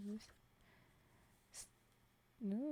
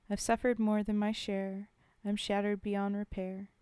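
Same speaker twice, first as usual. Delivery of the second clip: poem reading; 5 dB louder than usual. Acted. Streamer reading a war poem to chat, solemn. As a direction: read it like a soldier's last words.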